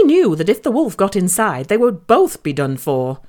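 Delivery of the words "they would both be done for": ordinary delivery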